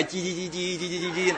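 soft, rapid little cheeps; chick cheeping. Pretend. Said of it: "让我演小黄鸡". (小声模仿小鸡叽叽叫)

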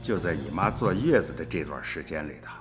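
ordinary delivery

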